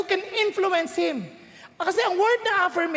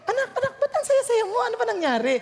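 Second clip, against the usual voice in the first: put-on higher, laughing surprise at the child; excited parent; 5 semitones higher, faster than usual. Parent asking happy child why, excited. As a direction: gush over the child's joy.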